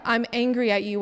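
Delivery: ordinary talk, nothing marked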